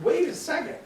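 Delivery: sharp high "Wait a second."; startled protest. Retold or imitated. Lawyer protesting to the judge, startled and high. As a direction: jump in surprised, pitch leaping.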